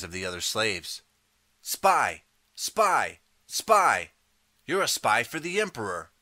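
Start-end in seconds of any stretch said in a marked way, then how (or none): 1.6-6.1 high, loud repeated accusation; old man's angry yell. A narrator's reading. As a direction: point and yell at the spy. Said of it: "the old man yells".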